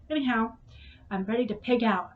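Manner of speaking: ordinary talk, nothing marked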